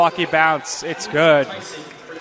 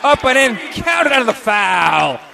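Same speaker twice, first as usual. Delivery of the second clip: higher, thrilled play-by-play; excited call; 4 semitones higher than usual. Real, not acted. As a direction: ride the excitement of the and-one. Basket and foul on play.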